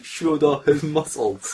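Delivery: slow, giggling delivery; laughing at the fanfic description; laughing while reading. Real, not acted. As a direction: read it cracking up.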